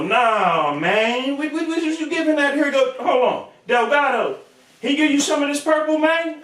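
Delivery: high incredulous squawk; Crunchy's shocked voice. Retold or imitated.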